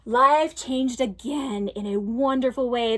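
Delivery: ordinary delivery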